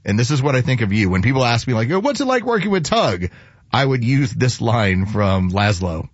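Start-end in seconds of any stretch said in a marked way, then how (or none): 1.9-3.3 higher-pitched imitation of someone asking; curious questioner. Host quotes people asking about Tug, higher mock-curious voice. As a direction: voice an eager asker, pitched up.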